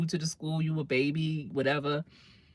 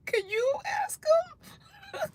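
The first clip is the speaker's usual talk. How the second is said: high, breathy near-cry plea; tearful little whisper begging; pleading whimper; 5 dB quieter, much slower than usual. Retold or imitated. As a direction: beg like a scared teen about to cry. Teen begging mother, voice breaking.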